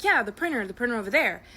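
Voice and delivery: high, loud and eager, pointing it out; insistent excitement. Acted. To the strange librarian, eagerly insisting the printer's there. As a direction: push it like it's obviously right there.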